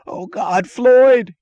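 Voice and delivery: high, loud panicked scream; screaming in terror. A narrator's reading. Mooch screams at Floyd to stop the torture, high and panicked. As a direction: shriek it in sheer panic. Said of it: "he screamed".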